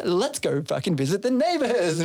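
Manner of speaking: rally a friend for a jaunt; playful rallying cry. Retold or imitated. Retold cheerful plan to make peace.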